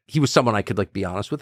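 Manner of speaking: normal talking voice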